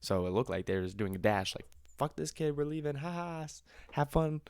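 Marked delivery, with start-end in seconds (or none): 1.9-4.4 mock parents ditching. Imagined parents ditching kid.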